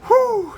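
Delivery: loud, high exhaled whoop; high "whew". Real, not acted. Reviewer collecting himself before signing off, high whew. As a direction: let out a high whew to recover. Catching breath after laughing.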